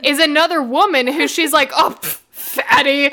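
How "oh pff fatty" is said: slow, scoffing dismissive voice; mock scorn. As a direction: sneer it in passing. Voicing Bella's scorn.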